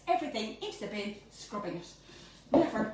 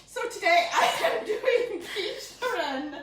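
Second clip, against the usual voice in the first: laughing; 6 semitones higher, 6 dB louder than usual. Real, not acted. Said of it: "Oh my God".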